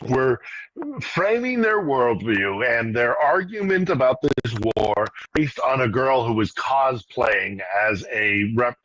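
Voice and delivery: usual speaking voice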